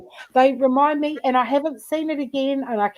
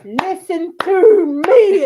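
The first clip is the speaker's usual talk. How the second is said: loud, slow and higher, like a big man bellowing; mock booming order; 6 semitones higher, 8 dB louder, slower than usual. Big guy at the protest bellowing at the women, mock loud and slow. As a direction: drag it out loud and raised. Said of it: "just goes".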